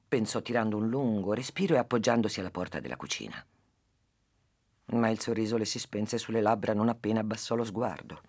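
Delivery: lively storytelling to a listener; story narration. Acted. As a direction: tell the fable with animated character voices. S0 tells a fable. (narrazione di una fiaba)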